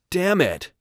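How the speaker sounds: high, loud burst of frustration; frustrated curse. Acted. Rob cursing at the news about his team, frustrated. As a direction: curse out in dismay.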